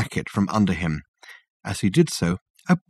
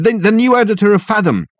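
panicked; 12 semitones higher, 5 dB louder than usual. Acted. Richard panicked, breathless.

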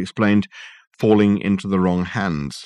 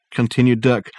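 usual speaking voice

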